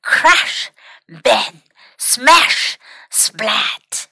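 each word set apart like a sound effect; punchy storybook noise words, pitch jumping about; terrible crashing noises. A narrator's reading. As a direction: act out the kitchen clatter word by word. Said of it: "They heard terrible noises".

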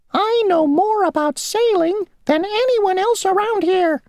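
very high, tiny, self-important insect voice; a little insect bragging; boastful mosquito. Acted. Mosquito boasting to Grasshopper mid-puddle, tiny high voice.

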